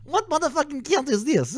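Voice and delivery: very high put-on caricature; mocking nerd voice. Streamer making fun of the lawyer, mocking nerd voice. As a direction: push it way up into a silly mock squeal. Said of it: "he sounds like a nerd-ass bitch boy".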